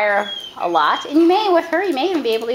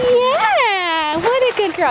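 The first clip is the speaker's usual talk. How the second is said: gushing praise; 10 semitones higher, much slower than usual. Shown as a demonstration. Trainer praising dog.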